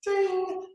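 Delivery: a high sung ding sound; very high bell-like "Ding"; mock ding. Shown as a demonstration. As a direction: ring it like a bell. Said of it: "sound effect ding".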